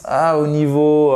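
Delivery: normal talking voice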